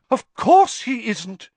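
high, loud, drawn-out exclamation; thoroughly excited. A narrator's reading. Professor dismisses the friend's question, thoroughly excited. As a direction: pounce on it with theatrical excitement. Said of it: "looking thoroughly excited".

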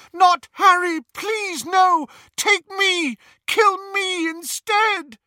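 high, loud, frantic begging; desperate pleading. Acted. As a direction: beg for your child's life. Mother begs for her son.

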